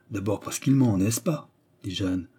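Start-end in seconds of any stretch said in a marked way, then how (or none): none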